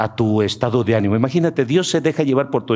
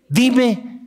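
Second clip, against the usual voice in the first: much higher, mock whining excuses; high whiny sinner; 14 semitones higher than usual. Sinner whines excuses to God, much higher.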